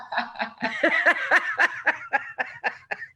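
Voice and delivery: high, helpless burst of laughter; bright real laugh fading away. Real, not acted. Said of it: "I could not stop laughing".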